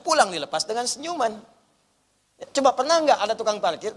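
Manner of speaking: usual speaking voice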